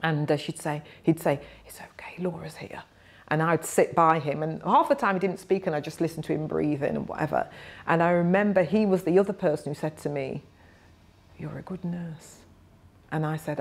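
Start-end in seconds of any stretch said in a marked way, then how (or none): 1.7-2.8 very soft, whispered reassurance; breathless whisper. Retold or imitated. Dying miner persuading his wife to take a break, breathless whisper. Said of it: "So he whispered". 11.4-12.4 very soft, gentle imitated whisper; a weak patient's tender praise, barely voiced; breathless whisper. Dying miner to the student nurse, breathless whisper. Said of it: "So he whispered".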